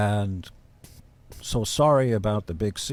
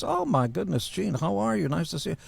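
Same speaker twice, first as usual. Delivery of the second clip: much higher, delighted woman's greeting; Suzanne Somers imitation; 8 semitones higher than usual. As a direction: mimic her thrilled surprise in a high voice. Retells Suzanne greeting the caller.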